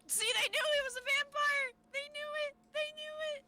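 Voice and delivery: high excited shout; gleeful i-told-you-so. Real, not acted. Excited gloating about the vampire.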